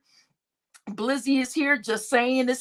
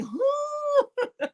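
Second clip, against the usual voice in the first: high squeaky confused huh; a stunned, disbelieving huh; mock baffled huh; 14 semitones higher than usual. D still baffled.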